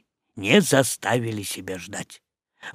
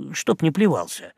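usual speaking voice

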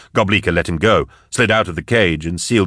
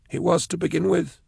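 higher, quieter worried man; Blake voice; 11 semitones higher, 5 dB quieter than usual. Acted. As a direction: lift the voice, uneasy and tight. Blake's dry reply.

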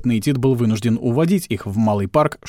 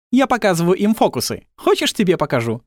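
Tilly voice; 7 semitones higher than usual. Acted. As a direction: chirp at him, pitched up.

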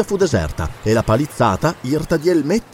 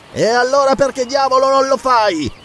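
high-pitched, angry call back; raised, irritated shout; irate retort; 11 semitones higher than usual. A narrator's reading. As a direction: throw it back angrily across the distance. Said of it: "disse con ira Zarono".

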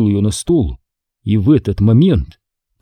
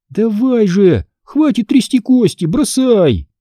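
high-pitched exasperated plea; groaning impatiently; 11 semitones higher, slower than usual. A narrator's reading. Girl urging boy to throw dice, groaning. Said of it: "простонала Эмили".

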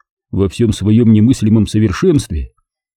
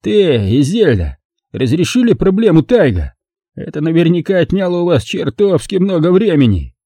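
lightly voiced drunk sneerer, pitched higher; a hint of a bitter drunk; higher sneering man, lightly played; Brogan's voice; 8 semitones higher than usual.

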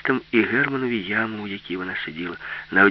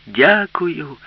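raised, higher-pitched call; 6 semitones higher, 6 dB louder than usual. A narrator's reading. Alisa calling back to the departing dolphin, raised voice. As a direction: call your thanks out across the water. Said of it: "крикнула йому Аліса".

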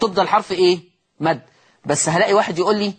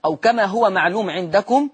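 ordinary delivery

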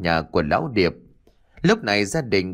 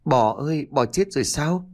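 understated wail rising into a question; hopeless cry; 5 semitones higher than usual. A narrator's reading. Ly cries out to her dead dog in a dream, despairing. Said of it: "gào lên trong vô vọng".

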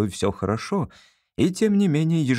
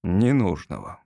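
low, falling echo of the word; doubting echo; 5 semitones lower than usual. Acted. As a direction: repeat «Ненужного» low, as the doubting father. Father echoes son in doubt.

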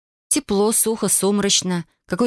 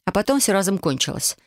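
usual speaking voice

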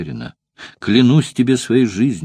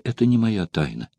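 plain reading voice

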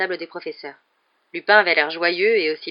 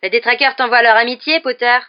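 loud, higher sneering call across the crowd; jeering shout; 5 semitones higher, 9 dB louder than usual. A narrator's reading. Malfoy taunting Harry across the crowd, loud jeering shout. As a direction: yell it to taunt him. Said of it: "lorsqu'il cria".

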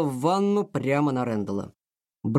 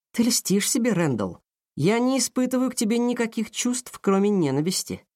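firm; 8 semitones higher than usual. A narrator's reading. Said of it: "твёрдо сказала Аврора".

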